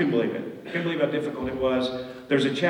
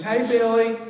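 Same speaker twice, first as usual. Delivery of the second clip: Mississippi greeting; 9 semitones higher, 6 dB louder than usual. Retold or imitated. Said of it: "You know, Mississippi".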